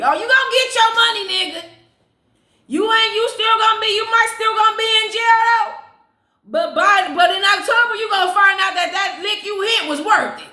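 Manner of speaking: hyped yelling about the money; excited outburst. Real, not acted. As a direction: shout it out, thrilled at the news. Excited reaction to the fortune.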